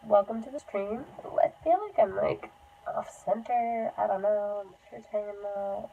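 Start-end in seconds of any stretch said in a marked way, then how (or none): none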